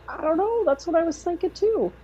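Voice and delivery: high, sing-song I-don't-know; telling her husband she agrees, high and helpless; retold helpless agreement. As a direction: squeak it up helplessly.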